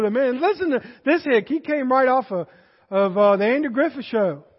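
ordinary talk

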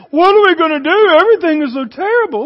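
loud, high, hammed-up fretting; mock despair. Church sitting and fretting, mock despairing wail. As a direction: wail it like a helpless worrier. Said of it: "When the church is sitting here".

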